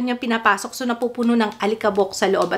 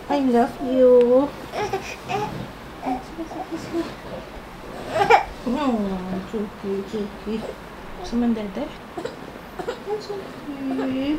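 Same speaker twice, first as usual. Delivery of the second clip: soothing hush; 7 dB quieter than usual. Real, not acted. Mother calming her baby, soft slow murmuring.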